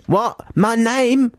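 very high mock bewilderment; James confused. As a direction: squeak out a put-on puzzled question. Voices James confused about his name.

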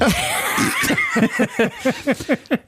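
a burst of high laughter; big high laugh. Real, not acted. Host cracking up at his own joke, high laugh.

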